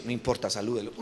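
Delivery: low, soft insistence as God. Acted. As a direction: overrule quietly, in a deep hushed voice. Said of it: "¿Cómo es la voz de Dios?".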